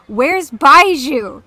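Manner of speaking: loud, high mock-feral yell, drawn out; unhinged outburst. Fan ranting at a game survey, unhinged yell. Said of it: "embarrassingly unhinged".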